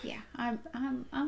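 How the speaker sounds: hushed whisper. Real, not acted. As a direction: breathe it out. Reacts in whisper.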